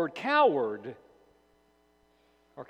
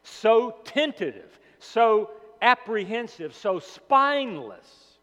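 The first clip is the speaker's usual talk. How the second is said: rebuking Jesus; 5 semitones higher, 6 dB louder, slower than usual. Pretend. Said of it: "Jesus says".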